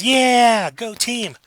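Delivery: high-pitched crowd cheer, loud at the start; mock team cheer. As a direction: imitate a fired-up team. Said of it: "And everybody goes".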